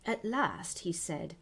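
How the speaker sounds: ordinary delivery, nothing marked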